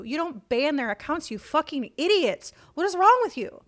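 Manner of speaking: real outrage peaking at the end; angry rant. Real, not acted. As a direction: yell at them like idiots. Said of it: "I'm very passionate about this".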